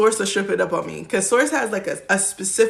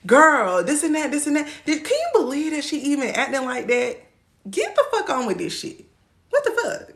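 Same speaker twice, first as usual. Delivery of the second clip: put-on higher-pitched dishing to a girlfriend; animated mock outrage, raised pitch; gossiping girlfriend; 6 semitones higher than usual. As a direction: act out an incredulous friend venting. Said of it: "The way that you would talk to your girlfriend".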